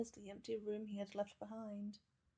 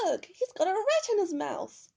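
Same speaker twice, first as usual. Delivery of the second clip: high, loud shriek; disgusted scream; 9 semitones higher, 12 dB louder than usual. A narrator's reading. Garden lady seeing a rat in the dog's mouth, screaming. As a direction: shriek in disgust, much higher and louder. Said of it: "she screamed".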